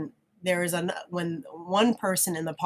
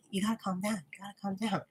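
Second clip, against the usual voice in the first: softer, hushed calming words; soothing; 7 dB quieter, faster than usual. Retold or imitated. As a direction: gently talk him down. Soothing partner during his rage.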